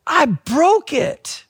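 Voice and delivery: put-on high, wailed little-girl voice; imitated tearful child. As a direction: mimic a distraught child confessing, drawing out the last. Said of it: "just tears rolling down her face".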